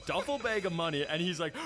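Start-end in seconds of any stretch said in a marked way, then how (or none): none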